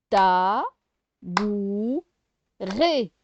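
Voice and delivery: "Ta, bou, ré" in separate, drawn-out beats; syllable by syllable. Shown as a demonstration. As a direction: break it into "Ta", "bou", "ré" with clear pauses. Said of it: "on fait les syllabes". (« Ta, bou, ré » syllabe par syllabe)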